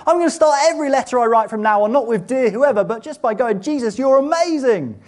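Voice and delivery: loud, high, impassioned sermon climax; fervent preaching. Acted. Preacher proclaiming Christ's victory to the congregation, loud and fervent. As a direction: preach it with full fire to the congregation.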